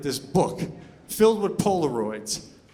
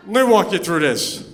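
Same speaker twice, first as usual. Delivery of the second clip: usual speaking voice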